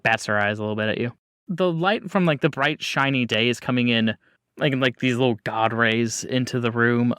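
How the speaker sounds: normal talking voice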